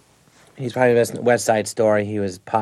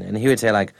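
ordinary talk, nothing marked

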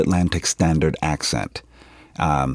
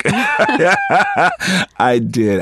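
laughing admission; 14 semitones higher, 5 dB louder than usual. Real, not acted. Laughs at being found out.

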